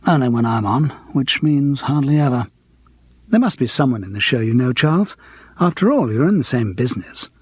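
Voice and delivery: ordinary delivery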